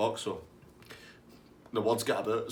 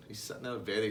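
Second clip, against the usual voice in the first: normal talking voice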